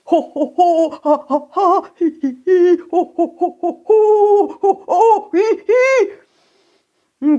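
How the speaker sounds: high tickled giggling. Acted. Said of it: "ein Kichern, das ganz offensichtlich vom Zauberweg kam".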